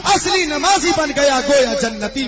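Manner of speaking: rising, loud, high impassioned sermon; fiery preaching. Acted. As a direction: build the plea to God into a shout. Preaching climax, weeping plea.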